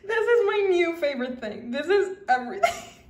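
high loud squealing laugh into the words; real delight. Real, not acted. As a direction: laugh with joy. Overjoyed at gift.

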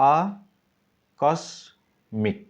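আ, কস, মিক as separate beats; syllable by syllable. Shown as a demonstration. Teacher splitting আকস্মিক into syllables. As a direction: break আকস্মিক into three parts. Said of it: "তাহলে আকস্মিক পড়ো".

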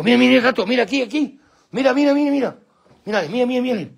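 very high, rapid-fire repeats to the dogs; excited urging. Real, not acted. Owner alerting his dogs to a cat, excited. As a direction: whip the dogs up, pitched right up.

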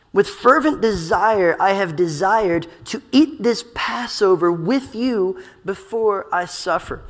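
slow, weighted scripture quote; intense fervor. Retold or imitated. Preacher quoting Jesus before he suffers, slow and fervent. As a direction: measure out each phrase with earnest intensity. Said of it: "hear the intensity of his words".

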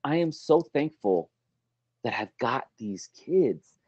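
mean every word of thanks; hushed grateful whisper-like thanks; sincere thanks. Shown as a demonstration. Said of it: "You go".

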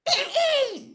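put-on high, loud, girlish "hem hem"; prim, high-pitched fake cough; affected throat-clearing. A narrator's reading. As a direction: clear your throat in a squeaky pitch to interrupt. Umbridge interrupts with her little cough.

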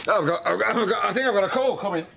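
laughing nervously, fast; laughing through embarrassment; flustered laugh. Acted.

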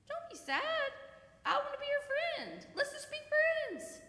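put-on very high-pitched boy voice; eager new friend. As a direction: imitate an eager kid offering friendship. Voices the boy befriending her.

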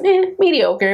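dismissive meh. Real, not acted. Rating the sex dismissively.

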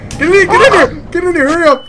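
panicked high yelling; being chased. Real, not acted. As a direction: urge friends to run, frantic. Fleeing monsters in game.

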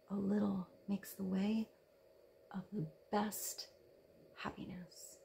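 hushed, breathy whisper dropping to nothing; soft whispered quote. Acted. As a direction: whisper each word like a secret. Reading a Nietzsche quote softly.

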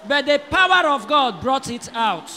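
loud, rising preacher shout; preaching. Acted. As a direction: preach it hard to the congregation. Pastor preaching at full force.

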